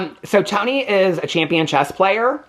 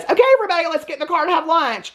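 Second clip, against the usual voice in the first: much higher sing-song call; mock chirpy Christine; 12 semitones higher, faster than usual. Mimicking Christine calling everyone to lunch, high chirpy. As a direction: call the group like a peppy mom.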